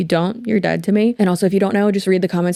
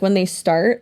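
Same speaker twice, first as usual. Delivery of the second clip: ordinary delivery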